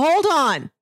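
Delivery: ordinary talk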